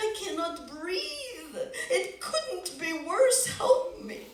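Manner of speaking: high, desperate pleading; poor man pleading for help; begging Moishe. Acted. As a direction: beg the rabbi for rescue.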